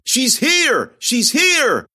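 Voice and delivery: high, loud sing-song call; eerie announcing call. A narrator's reading. Possessed girl betraying the heroine, a loud eerie cry. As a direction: cry out like a chanting crowd. Kids chanting, pointing.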